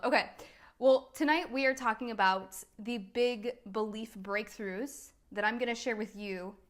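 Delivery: ordinary talk, nothing marked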